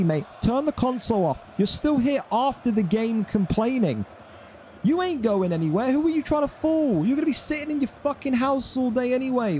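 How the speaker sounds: high, heated telling-off; heated rant. Real, not acted. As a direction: berate him, voice up high. Ranting at a chat complainer.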